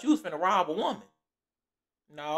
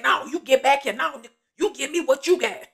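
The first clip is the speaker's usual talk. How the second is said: loud, high mock yell at the robber; retold yell; 7 semitones higher, 7 dB louder than usual. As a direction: bark it back at him like you're pulling him in. Fighting off a robber.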